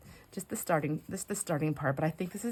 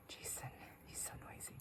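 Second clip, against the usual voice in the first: hushed aside to the camera; real whisper; 21 dB quieter than usual. Real, not acted. Complaining to viewers that her husband is noisy, whispering. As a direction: whisper so the husband won't hear.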